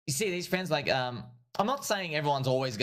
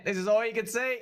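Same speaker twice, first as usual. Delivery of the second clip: amused exclaim; 10 semitones higher than usual. Real, not acted. Mocking the tiny chart.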